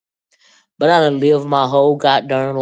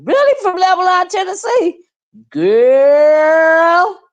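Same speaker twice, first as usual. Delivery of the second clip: amused disbelief; 13 semitones higher, 4 dB louder, slower than usual. Real, not acted. Answering Lil Precious's accent comment with high amused disbelief.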